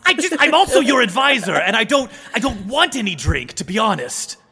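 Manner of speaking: high, flustered courtier voice; protest, then hurriedly give in; old adviser, protesting. Acted. Adviser being sent off to drink, flustered protest.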